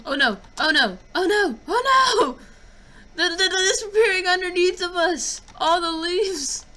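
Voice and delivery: rising panicked oh no; panicked cries. Real, not acted. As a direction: cry out in real panic. Panic as leaves vanish underfoot.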